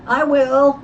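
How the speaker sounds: high, loud, eager volunteering; eager chorus. A narrator's reading. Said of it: "chorused Dave, Tex, and Bud".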